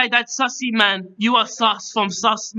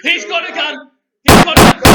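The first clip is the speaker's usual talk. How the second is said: hammed-up panicked yell, louder; mock panic; 3 semitones higher, 9 dB louder than usual. Host yelling in mock panic about the gun. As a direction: shout the warning in fake panic.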